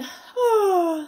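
drawn-out sleepy yawn; big yawn. Acted. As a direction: yawn it out loud. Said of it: "with a yawn".

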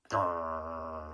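deep, soft dog growl; low growl. Shown as a demonstration. Narrator leading viewers' dogs, a low growl. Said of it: "all you dogs watching at home, growl".

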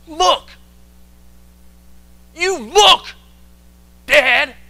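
loud, high-pitched angry outburst; angry son. Acted. Angry son confronts his father, shouting angrily. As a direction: shout it accusingly in short bursts. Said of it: "the angry son. He says".

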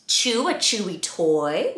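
normal talking voice

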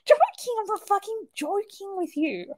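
hammed-up indignant insistence; insistent mock reply. Mum insisting to daughter she really does it, hammed-up. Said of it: "I was like".

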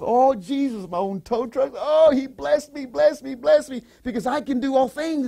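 high, loud cry of blessing; ecstatic praise. Real, not acted. Preacher rejoicing over his blessings, high and loud. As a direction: burst out rejoicing.

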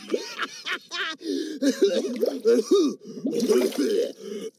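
high, whooping belly laugh; hammed-up uncontrollable laughter, tumbling down; frog's laughing fit. A narrator's reading. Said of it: "Tiddalik was laughing".

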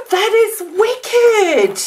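high, slow, thrilled and almost crying. Real, not acted. Praising Steph's snow globe, thrilled and teary. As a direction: stretch it out in teary awe.

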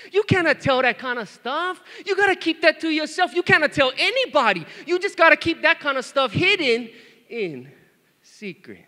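high, loud hammed-up reprimand; mock scolding. Dad lecturing daughter about secrets, hammed-up scolding. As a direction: scold in mock outrage, then drop to a hush.

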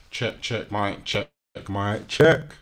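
loud, punchy mic test; mic check. Real, not acted. Streamer testing sound levels before the show, loud mic check.

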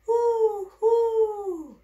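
high, loud owl hoo; playful owl call to a baby; owl hoot. Acted. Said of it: "What does an owl say?".

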